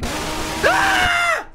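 very high shriek of fright; startled scream. Real, not acted. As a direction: shriek at the sudden scare. Startled by jumpscare.

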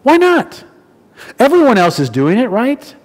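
high, questioning self-justification; self-justifying. Acted. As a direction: talk yourself into it, pitch jumping up. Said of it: "to say to ourselves".

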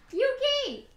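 high sharp call of the name; calling the cat off the piano; startled call to cat. Real, not acted. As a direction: catch the cat in the act.

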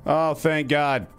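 high, slow cry of relief; drawn-out, heartfelt relief; relieved. Real, not acted. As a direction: let relief pour out slowly, pitched high. Has just escaped in game.

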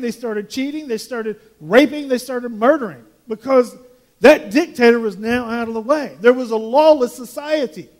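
loud, driving sermon voice, hammering each point; fiery preaching. Acted. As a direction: pastor warning the congregation about a lawless society, preaching loudly. Preaching at full force.

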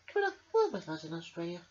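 put-on quick, light little girl voice. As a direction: invite the bear cheerfully. Emily voiced in read-aloud story.

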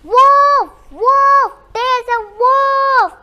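high, very loud drawn-out cry; full-voiced, high-pitched cry of alarm; shouting the alarm. A narrator's reading. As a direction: yell it out to the whole village. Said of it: "He shouted out".

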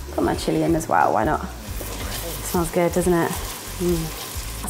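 ordinary talk

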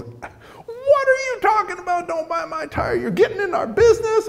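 very high squawking outrage; hammed-up indignant squeal, far above his own voice; mock angry yelling. Old tire seller to the man meddling in his sale, mock yelling. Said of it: "he's yelling at me".

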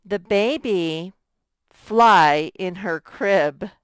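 slow wrong try. Shown as a demonstration. Teacher slowly tries a wrong word for the class. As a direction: say it slowly to hear it's wrong.

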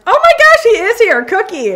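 high, loud delighted squeal at the dog; bursting with joy as the dog appears; excited. Real, not acted. Greeting the dog Cookie as he walks in, overjoyed and loud.

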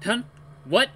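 ordinary delivery, nothing marked

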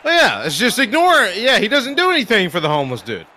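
high, shouted sarcastic rant; loud ranting. Real, not acted. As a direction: shout it out in exasperation. Mock outrage at the song's message.

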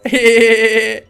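put-on high, drawn-out childish giggle; baby giggle. Baby laughing at dad, high mischievous giggle. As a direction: giggle high and loud like a cheeky toddler.

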